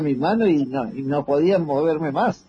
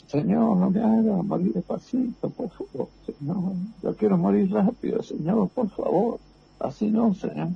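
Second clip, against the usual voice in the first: high, pleading voice begging God; desperate prayer; 7 semitones higher than usual. Retold or imitated. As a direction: beg the Lord for a quick death, pitched high. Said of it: "y le dije". (plegaria desesperada y aguda)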